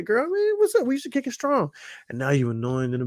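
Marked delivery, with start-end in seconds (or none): 0.0-1.7 put-on high-pitched old-friend greeting; hyped, high mock catch-up; acted excited reunion.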